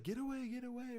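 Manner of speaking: high, retold yell at a charging dog. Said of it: "And I'm screaming, I'm yelling at it".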